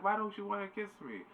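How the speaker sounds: very high mock whine; pleading Lucy. Lucy turned down by Jack, high hammed-up pleading.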